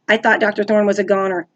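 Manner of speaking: ordinary delivery, nothing marked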